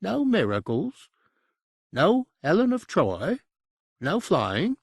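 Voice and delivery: high, disbelieving list of wishes; incredulous demon. A narrator's reading. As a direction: plead in disbelief, voice pitched high. Demon pleading for more.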